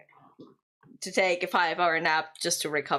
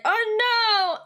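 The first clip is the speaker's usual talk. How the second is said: much higher and louder, a cat's mock alarm; cat's panicked voice; 14 semitones higher, 5 dB louder than usual. Streamer voicing her cat when she leaves, panicked high squeak. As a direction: squeak it like a worried pet. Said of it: "Ampan is like".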